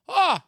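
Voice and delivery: high, loud shocked "oh"; imitated woman's cry. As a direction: yelp it out like the startled woman. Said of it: "like that".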